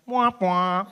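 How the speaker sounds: high, loud mock sad-horn wah; doctor signalling failure with a sad wah wah; sad trombone noise. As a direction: imitate a losing game sound.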